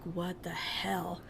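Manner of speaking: hushed, slow whisper of disbelief; stunned whisper. Retold or imitated. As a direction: breathe it out under your breath. Stunned aside while being taken in.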